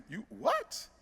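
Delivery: quiet, mock-stunned rising question; incredulous reply. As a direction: imitate disbelief, swooping up on "what". God's incredulous reply, retold.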